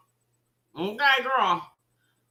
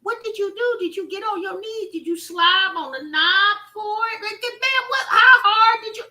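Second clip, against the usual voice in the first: mock incredulous; 9 semitones higher, 3 dB louder than usual. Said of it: "And I said".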